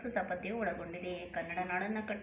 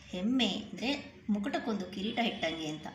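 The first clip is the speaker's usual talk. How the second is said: ordinary talk, nothing marked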